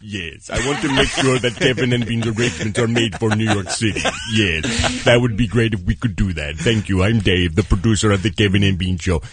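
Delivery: low, formal Sulu-like business voice; Dave's producer voice. Retold or imitated. Cohost as producer confirming travel arrangements, low and official. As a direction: drop low and over-enunciate like an officer. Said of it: "doing our impression of him".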